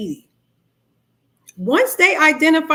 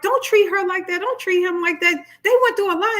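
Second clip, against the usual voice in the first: defending protest; 7 semitones higher than usual. Retold or imitated. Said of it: "you're like".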